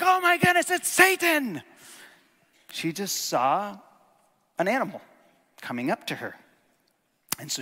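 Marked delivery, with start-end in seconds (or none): 0.0-1.6 high, loud mock alarm; startled cry. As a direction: squeal it like a shocked Eve. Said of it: "She didn't turn around and be like".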